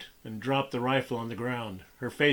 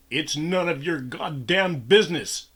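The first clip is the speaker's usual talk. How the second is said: higher, louder furious yell; raging yell; 4 semitones higher, 4 dB louder than usual. A narrator's reading. Boss yelling back at his worker, raging yell. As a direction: spit it back shaking with rage. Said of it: "Mike yelled, shaking with rage".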